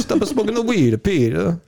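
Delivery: high, slow hammed-up Italian dad; Italian dad accent. Friend imitating Peter's dad in a mock Italian accent, slow and high. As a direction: wag a finger in a thick Italian accent. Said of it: "strong Italian accent".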